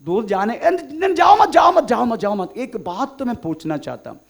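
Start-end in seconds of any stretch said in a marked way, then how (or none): 0.6-2.5 high, loud repeated plea to stop; urgent calling back. Acted. Mary calls to the departing angel not to go, shouting urgently. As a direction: call out urgently to halt someone walking off. Acting out stopping someone leaving.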